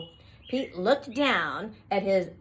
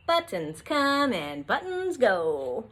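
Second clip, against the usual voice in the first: plain reading voice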